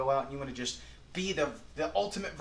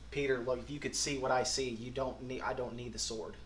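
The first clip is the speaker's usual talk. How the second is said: gentle; 4 semitones lower, 5 dB quieter than usual. Retold or imitated. Jesus speaking gently to Peter.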